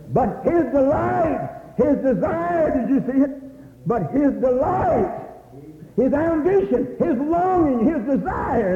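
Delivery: high, slow, emphatic listing; high preacher's litany; fervent preaching. Real, not acted.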